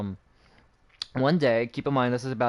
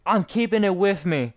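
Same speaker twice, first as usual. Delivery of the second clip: higher, emphatic retold defiance; defiant retort; 7 semitones higher, 4 dB louder than usual. Student refusing to give up his skateboard, retold defiance. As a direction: push back, acting out your refusal. Retelling defiant reply to administrator.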